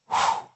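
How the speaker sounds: a long breath blown out over them. Shown as a demonstration. Jesus breathing the Spirit onto the disciples, a blown breath. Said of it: "breathed on them".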